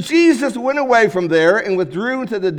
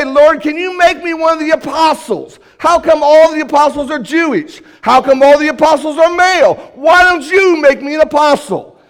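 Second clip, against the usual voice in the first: raised, louder mock plea to the Lord; hammed-up voice of the woman asking for more; mock demanding petitioner; 6 semitones higher, 5 dB louder than usual. Preacher voicing an imagined greedy request, raised and louder.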